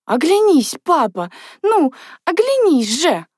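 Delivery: high, stretched-out begging; will him to turn round; silent pleading. A narrator's reading. Natasha begs her father in her thoughts, pleading. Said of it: "просила она мысленно".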